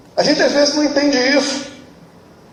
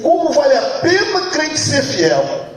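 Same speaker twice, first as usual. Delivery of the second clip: triumphant hymn line; 5 semitones higher, slower than usual. Retold or imitated. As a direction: belt it out in triumph. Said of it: "ele vai dizer assim".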